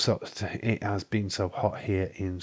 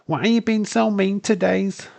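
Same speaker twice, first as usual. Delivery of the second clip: whiny; 12 semitones higher, 5 dB louder than usual. Retold or imitated. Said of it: "and go".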